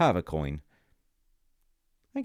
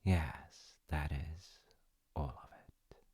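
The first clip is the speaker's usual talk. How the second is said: hushed; 5 dB quieter, slower than usual. A narrator's reading. Storyteller ends the tale hushed.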